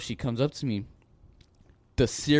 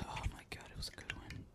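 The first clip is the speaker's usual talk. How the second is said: unvoiced, barely audible whisper; whispered aside; 23 dB quieter than usual. Real, not acted. Worker recalling a kid's slip, whispered aside. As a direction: whisper it to yourself.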